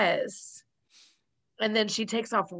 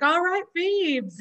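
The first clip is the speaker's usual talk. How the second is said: knowing tease; 9 semitones higher, slower than usual. Retold or imitated. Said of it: "And so he's like".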